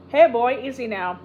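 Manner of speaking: loud call then easing down; calming a horse. A narrator's reading. Tex calling out to calm the excited horse. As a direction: call out to settle the frightened animal.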